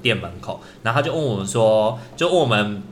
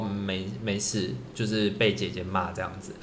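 tearful murmur; 3 semitones lower, 9 dB quieter than usual. Retold or imitated. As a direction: barely get it out through tears. Crying girl answers senior. (哽咽着小声说)

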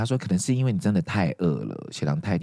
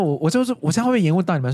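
fast, higher-pitched worried question; hurried, mildly anxious apology; retold anxiety; 6 semitones higher, 3 dB louder, faster than usual. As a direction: act out nervously fretting about holding people up. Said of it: "然后我就很害怕说".

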